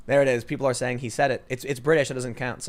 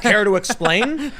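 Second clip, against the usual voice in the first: mock reporter; 8 semitones higher, 6 dB louder, slower than usual. Mocking a reporter's question.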